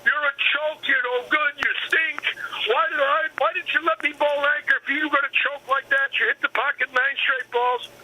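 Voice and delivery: high-pitched mock tirade; high-pitched mock yell calling him a choke; retold yelling. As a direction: mimic a furious partner berating you, pitched high. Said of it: "He's yelling at me".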